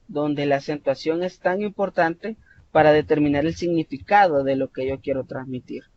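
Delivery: ordinary talk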